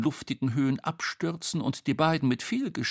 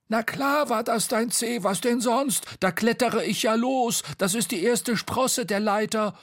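high, insistent character voice; Bo, impatient; 13 semitones higher, 4 dB louder than usual. Acted. Bo dismissing Tete's sore toe, high and impatient. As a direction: brush off the complaint, high and forceful. Story figure Bo speaking.

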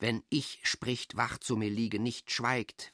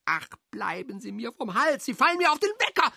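from a high irritated sigh into a much higher, louder, fast shriek; snap, then blow up shrill and quick; exasperated outburst; 10 semitones higher, 5 dB louder, faster than usual. Acted. Driven mad by a pestering visitor, shrieking high and fast.

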